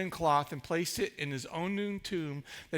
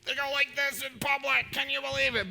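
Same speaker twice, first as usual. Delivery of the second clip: mock shocked visitor; 5 semitones higher than usual. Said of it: "It's like".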